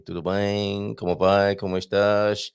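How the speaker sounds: ordinary talk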